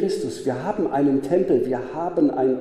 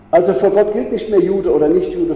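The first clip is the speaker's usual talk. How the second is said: louder, ringing proclamation of vor Gott gilt nicht mehr Jude oder Nicht-Jude; emphatic preaching; 4 semitones higher, 7 dB louder than usual. Real, not acted. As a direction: proclaim it out to the hall. Preacher repeats key line emphatically.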